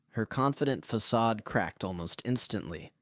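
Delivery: ordinary delivery, nothing marked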